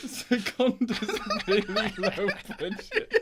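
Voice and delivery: slow, high words broken by laughter; real giggles all through the remark; laughing. Real, not acted. Host explaining why his pun is bad, laughing.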